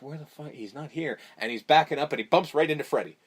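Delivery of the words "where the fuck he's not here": much softer, muttered confusion; quiet puzzled aside; hushed. Retold or imitated. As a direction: search the room under your breath. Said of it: "Carlos is like".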